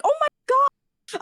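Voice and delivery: high, slow panicked gasp of words; scared squeak. Retold or imitated. Caught screenshotting his story, a high scared squeak.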